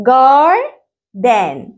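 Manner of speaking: Garden divided as a long rising GAR, a pause, then a falling DEN; split into syllables. Shown as a demonstration. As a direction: sound out GAR... DEN in two beats. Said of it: "هنقسمها إزاي؟".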